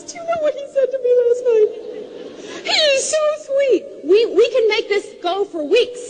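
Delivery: high, fast gushing excitement with a teary catch; excited wife. Retold or imitated. Wife phoning her friends about his words, high and gushing. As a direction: gush to friends, almost squealing. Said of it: "She's calling up all her friends".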